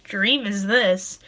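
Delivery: crying quietly through the words. Real, not acted. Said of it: "I'm sorry for crying".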